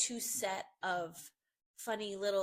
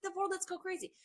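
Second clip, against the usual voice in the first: mock wild glee; 11 semitones higher, 3 dB louder than usual. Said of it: "It's this, like".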